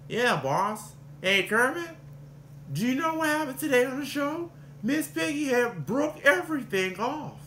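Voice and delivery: high, gossipy stagehand voice; Scooter impression. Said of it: "The other one is Scooter".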